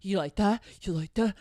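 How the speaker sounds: lightly voiced guy asking again and again; mock a guy's sleazy question; imitating guy's dirty talk. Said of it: "He's like".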